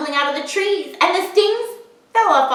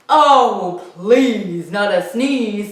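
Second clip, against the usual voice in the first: low, slow bear voice; a lumbering bear, pleading low; 5 semitones lower, much slower than usual. A narrator's reading. Bear begging the elephant not to sneeze. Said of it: "said the bear".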